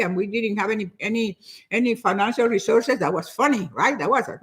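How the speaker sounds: ordinary delivery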